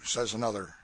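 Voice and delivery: ordinary delivery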